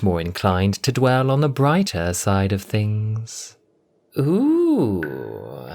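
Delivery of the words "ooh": cooing. Acted. Cassandra coos at her new reflection. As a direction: coo with delight at the new body. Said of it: "she cooed".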